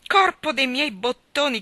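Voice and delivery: put-on roaring boy's oath, slowed for effect; laughing oath. A narrator's reading. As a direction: bellow the oath in mocking glee. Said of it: "dal gran ridere".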